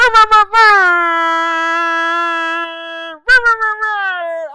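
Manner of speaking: blaring mouth-made air horn; air horn imitation. Shown as a demonstration. Host hyping a guest in the chat, loud air horn imitation. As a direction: trill out a very loud horn blast with your voice. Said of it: "trying to give it to you for my sound effects".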